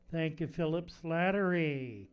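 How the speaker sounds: ordinary delivery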